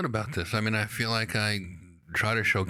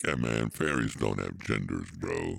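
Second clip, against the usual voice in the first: very deep, monotone fairy; deep-voiced dude of a fairy; low register; 4 dB quieter than usual. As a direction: drop to the bottom of your voice. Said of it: "to pretty low register".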